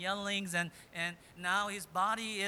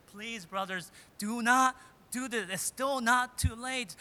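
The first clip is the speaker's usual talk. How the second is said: louder, higher begging, slowing at the end; pleading; 5 semitones higher, 6 dB louder than usual. Retold or imitated. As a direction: plead with the brothers with the last strength. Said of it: "I imagine Joseph pleading".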